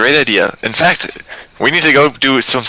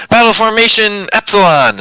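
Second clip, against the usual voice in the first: higher, louder battle cry; mock battle yell; 8 semitones higher, 4 dB louder than usual. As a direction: call it out like a rallying squad leader. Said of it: "we just yell".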